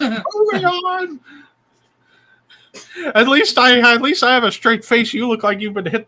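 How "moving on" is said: mock squeal. Hurrying the bit along.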